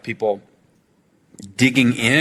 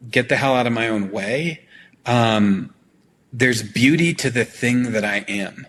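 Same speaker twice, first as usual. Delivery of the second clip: ordinary talk, nothing marked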